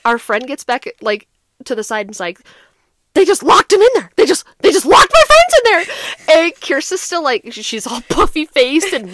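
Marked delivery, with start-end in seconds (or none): 3.1-5.8 high, loud, frantic imitation; agitated retold protest. Friend telling policeman they got locked in, mock-frantic. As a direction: protest like an alarmed friend, voice raised.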